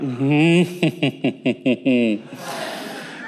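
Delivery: a long warm laugh, half to himself; real laugh. Real, not acted. Preacher laughing at the congregation's answer.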